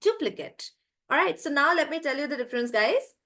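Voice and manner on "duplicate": "duplicate" higher, big DU; "duplicate" as "DU-pli-kut"; stress on DU. Shown as a demonstration. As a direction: hit DU, shrink "duplicate" to a "kut" ending. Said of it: "I'm stressing on do".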